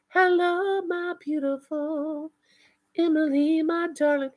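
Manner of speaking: a high, tender, drawn-out hello; sweet high greeting. Real, not acted. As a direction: coo a welcome to a dear friend.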